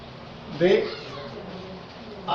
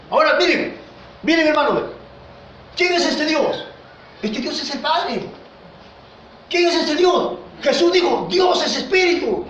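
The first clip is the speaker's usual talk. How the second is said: raised, emphatic sermon voice; fervent preaching; 5 semitones higher than usual. Acted. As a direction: preach it out with full conviction. Preacher exhorting the congregation. (predicación ferviente en voz alta)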